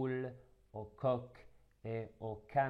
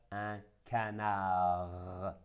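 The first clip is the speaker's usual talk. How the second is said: slow, syllable by syllable: un ca-nard; slower than usual. Shown as a demonstration. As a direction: split canard into its syllables slowly. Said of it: "Après le découpage".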